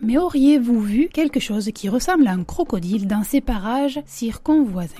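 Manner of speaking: usual speaking voice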